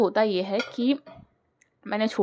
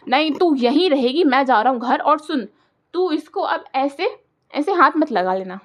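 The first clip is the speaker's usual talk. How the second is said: higher, loud angry brother's orders; brother's outburst; 3 semitones higher, 4 dB louder than usual. Retold or imitated. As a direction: shout orders at the sister. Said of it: "मेरे भाई का जो ज्वालामुखी फूटा है". (गुस्से में डांटता भाई)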